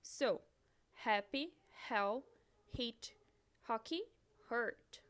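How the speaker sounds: each English word said clearly with pauses; teacher reading a vocabulary list in English; English model words. Shown as a demonstration. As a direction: model the English pronunciation for repeating. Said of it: "vai ter som de R".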